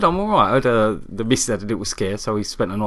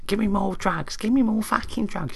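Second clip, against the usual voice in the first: high, rough mock chav demand; 10 semitones higher than usual. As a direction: whine it like a demanding chav patient. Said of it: "You can hear in her voice".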